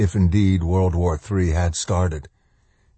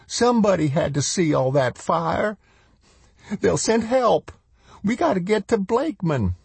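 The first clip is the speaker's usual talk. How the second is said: much higher woman's voice; high-pitched, rising toward hysteria; woman edging toward hysteria; 12 semitones higher than usual. A narrator's reading. Woman insisting help will come, near hysterical. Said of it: "the sound edging toward hysteria".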